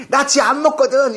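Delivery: high, loud laughing mock yell; shouting. Refusing the cat's mouse, laughing mock yell. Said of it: "이러면서".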